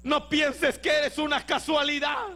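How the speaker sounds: predica a pleno pulmón, con gritos; predicación ferviente. Acted. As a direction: exhorta con fuerza a la congregación. Predica con fuerza al final. (el predicador anima a los hermanos, a gritos)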